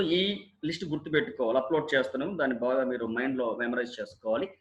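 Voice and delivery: ordinary talk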